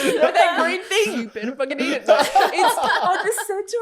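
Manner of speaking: jokey threat between laughs; laughing. Real, not acted. Laughing through mock threat to kids.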